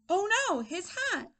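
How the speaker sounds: high storybook dismay; dismayed gasp. Acted. Teacher reacts to lost hat, high and dismayed. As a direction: exclaim as if just noticing a mishap.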